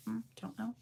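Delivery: whispered aside. Real, not acted. Unsure of viewer's name.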